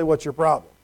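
low, matter-of-fact challenge; firm with a teenager; dry, no-nonsense parent; low, firm question. Retold or imitated. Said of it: "then maybe it's time to say".

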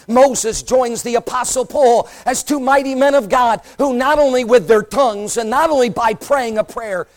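ordinary delivery

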